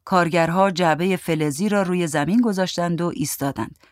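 ordinary delivery, nothing marked

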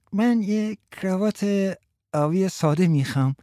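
high, put-on schoolkid voice; made-up schoolboy voice. A narrator's reading.

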